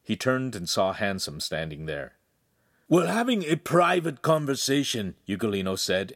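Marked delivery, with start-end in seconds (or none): 2.9-5.2 higher, indignant protest at being interrupted; object, caught out and flustered; flustered rebuke; embarrassed. A narrator's reading. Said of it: "embarrassed and getting to his feet".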